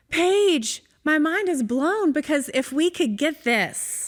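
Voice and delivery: high, loud and slow, overwhelmed with wonder; excited outburst. Retold or imitated. Friend back from the visit tells Paige she's amazed, excited shout. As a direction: call out her name like your mind is blown.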